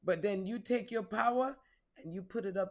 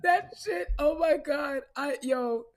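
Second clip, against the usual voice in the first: laughing; 7 semitones higher, 7 dB louder, slower than usual. Real, not acted. Cracking up at own joke.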